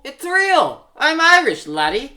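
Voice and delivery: put-on Irish accent. Actor insisting to Randolph that he is Irish, Irish accent. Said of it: "You have to do that awful Irish accent".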